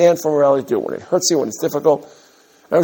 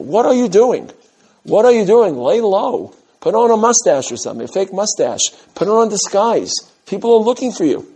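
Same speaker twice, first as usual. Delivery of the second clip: alarmed friend; 7 semitones higher than usual. Acted. Urging Moshe to hide.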